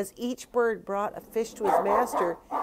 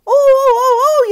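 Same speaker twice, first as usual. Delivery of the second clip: very high, very loud hollering; boy's yell; 19 dB louder than usual. A narrator's reading. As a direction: yell out like a boy in a struggle. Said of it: "yelled the boy".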